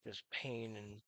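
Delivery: ordinary talk, nothing marked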